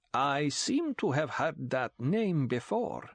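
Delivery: slow, silky, menacing Count. A narrator's reading. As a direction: purr it slowly, a veiled threat. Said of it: "The voice was silky".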